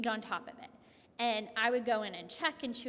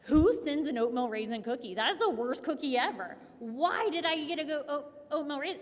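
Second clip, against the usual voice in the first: half-mock furious yell, much louder; retold angry rant; 4 semitones higher, 8 dB louder than usual. Mom retelling her oatmeal raisin meltdown, mock-furious yell. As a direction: replay the cookie outrage as a loud rant. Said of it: "I was screaming".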